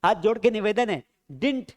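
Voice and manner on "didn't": didn't as "dint", in one syllable; correct pronunciation. Shown as a demonstration. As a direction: model didn't without its middle vowel, "dint". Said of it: "क्या पढ़ेंगे, didn't".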